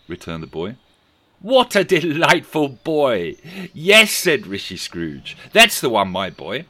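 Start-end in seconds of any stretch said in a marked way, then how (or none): 1.4-6.7 slow, high and loud with glee; delighted Scrooge. A narrator's reading. Scrooge delighted with the boy's answer, slow and loud. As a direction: savour the praise grandly.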